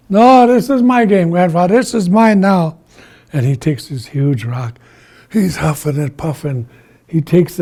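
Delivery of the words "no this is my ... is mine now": loud, high protest; stubborn, bossy yell; defiant. Acted. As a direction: shout back, claiming it as yours. Iktomi defiantly shouts back.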